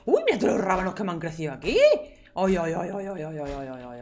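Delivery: delighted squeal. Real, not acted. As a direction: gush over them, overjoyed. Delighted at grown radishes. (encantada y emocionada, en voz alta)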